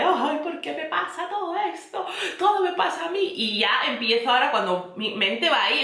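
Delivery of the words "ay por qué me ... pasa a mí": mock whiny lament, pitched higher; self-pitying drama. Mocking her own dramatic self-talk, whiny and higher. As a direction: ham up the poor-me complaint. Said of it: "soy muy dada al drama".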